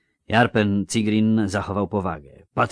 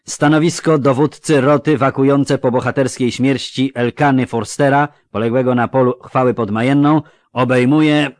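loud, raised official proclamation; high, forceful ceremonial announcing; booming announcement; 6 semitones higher, 10 dB louder than usual. A narrator's reading. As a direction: proclaim the appointment to the whole room. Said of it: "ogłosił gromko".

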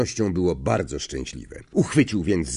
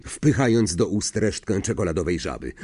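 ordinary delivery, nothing marked

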